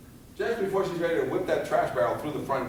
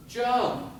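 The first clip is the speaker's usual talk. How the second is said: urgent shout; 10 semitones higher than usual. Retold or imitated. Mother below urges her child to jump, high shout. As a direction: call it up to the kid.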